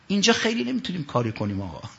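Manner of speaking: ordinary delivery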